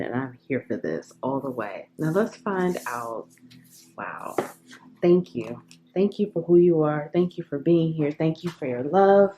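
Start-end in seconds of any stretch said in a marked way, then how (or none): 4.0-5.6 breathless whispered wow; awed whisper. Real, not acted. Reader stunned by the cards, whispering in awe. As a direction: hush it out in awe.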